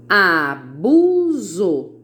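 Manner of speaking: "Abuso" stretched out as a, bu, so; loud, very slow "Abuso" in three syllables. Shown as a demonstration. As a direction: draw out each syllable of "Abuso". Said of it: "três sílabas".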